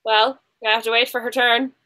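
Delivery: ordinary delivery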